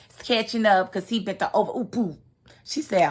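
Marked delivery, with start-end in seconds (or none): none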